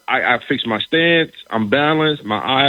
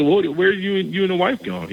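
high, incredulous question, pitched way up; retold surprise; 8 semitones higher than usual. To Aaron, puzzled by his Monday date night plans, surprised higher pitch. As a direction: ask it like you can't believe it, much higher.

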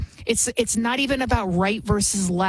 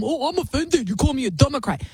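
high, mocking whiny voice; mock offended whine; 5 semitones higher than usual. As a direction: sneer it like a fake-offended victim.

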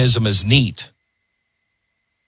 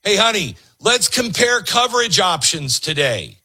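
higher, sing-song mock enthusiasm; mock cheerful spouse; 7 semitones higher than usual. A spouse mock-eagerly calling to honey, higher and chirpy. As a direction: fake excitement about coverage. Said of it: "nobody wakes up on a Saturday morning thinking".

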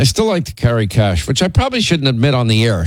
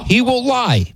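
mock accusation; 11 semitones higher than usual. Real, not acted. Accusing husband of food lies.

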